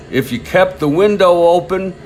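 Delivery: lightly performed, emphatic recollection of a ballplayer; Smokey Joe Wood. Retold or imitated. Retelling a train-ride interview to listeners, emphatic quoted voice. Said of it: "in his sort of southern accent, I can't do it".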